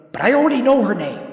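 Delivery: high, fast, flustered. A narrator's reading. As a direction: blurt it in a panic. Panicked self-talk.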